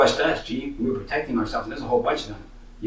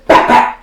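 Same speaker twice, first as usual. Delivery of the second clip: imitated punch sounds; 12 dB louder than usual. Storyteller turns around and swings, loud imitated punches. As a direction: double blow, loud and abrupt.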